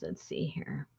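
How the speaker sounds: whispered to herself. Real, not acted. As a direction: mutter under her breath. Muttering while searching.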